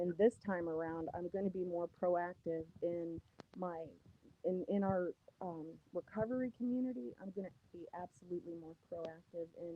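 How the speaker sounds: muffled, like through a wall; phone held wrong, sound smothered. Real, not acted. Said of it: "You, you, you sound muffled".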